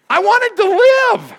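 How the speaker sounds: a loud, slow, heartfelt cry; impassioned cry. Real, not acted. Pastor telling the congregation he wanted to live, loud and high. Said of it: "pastor's emotional climax".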